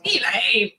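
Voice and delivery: slow, scornful put-down; dismissive woman. Retold or imitated. Women mocking a polite man with a slow scornful put-down. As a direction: drag it out, unimpressed. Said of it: "They're gonna be like".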